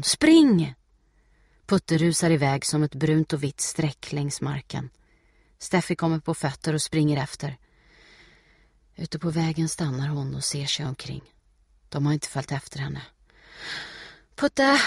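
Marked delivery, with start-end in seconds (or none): 0.0-0.7 high, loud falling shout; urgent call. A narrator's reading. Steffi urging Putte to escape, urgent call. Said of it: "ropar hon". 14.3-14.8 high, loud, sustained call. A narrator's reading. Steffi searching for Putte, calling out. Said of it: "ropar hon".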